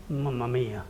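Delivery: a heavy, hushed "Mamma mia"; sad mamma mia. Shown as a demonstration. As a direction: let "Mamma mia" drop soft and sorrowful. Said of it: "used in a sad situation".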